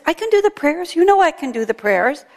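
high parrot voice insisting; insistent parrot. Acted. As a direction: papagaio teimoso, voz aguda.

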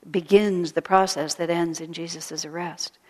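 usual speaking voice

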